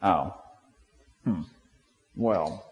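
grudging hesitation. Retold or imitated. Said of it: "Or are we like".

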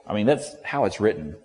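ordinary talk, nothing marked

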